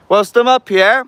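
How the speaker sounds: calling out. Acted. Calling out to spirits again.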